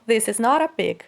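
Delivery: "not a" joined as "noɾ a" with a flapped T; linked consonant. Shown as a demonstration. Teacher demonstrating linking to students, joined sounds. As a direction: glide the T of "not" straight into "a". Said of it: "Então presta bem atenção".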